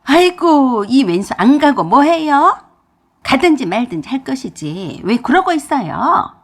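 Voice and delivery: high, loud exasperated yell; a mother-in-law yelling at her husband; loud outburst. Acted. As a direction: shriek at the useless husband. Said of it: "라고 큰 소리로 말했어요".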